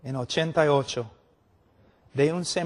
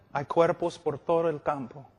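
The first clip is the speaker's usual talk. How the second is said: ordinary delivery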